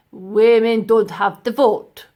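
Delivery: loud, slow curt snap; snapped. A narrator's reading. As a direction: cut her down. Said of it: "snapped the man".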